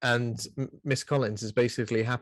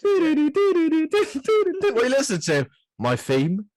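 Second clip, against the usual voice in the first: high teasing voice; 11 semitones higher, 4 dB louder, much slower than usual. Pretend. Imagining a killer on a plane.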